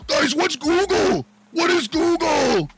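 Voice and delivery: high, slow mock-dumb voice, stretched out; sneering parody of confused fans; mocking dumb chat. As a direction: play the clueless viewer, dragging every word. Mocking clueless chat viewers.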